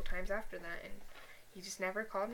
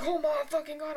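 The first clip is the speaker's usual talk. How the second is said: raised, louder cry of dismay; exasperated outburst, higher and louder; retold exasperation; 7 semitones higher, 7 dB louder than usual. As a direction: relive the dread, pitched up and loud. Retold reaction to his threatening call.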